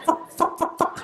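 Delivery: high, unvoiced clucks; clucking chicken. Comedian voices chickens strutting up to you, clucking. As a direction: cluck the word like a hen. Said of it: "coming up to you like that".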